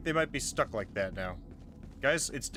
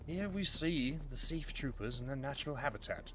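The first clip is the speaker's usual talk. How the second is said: nature documentary; 7 dB quieter than usual. Pretend. Narrating troopers like wildlife film.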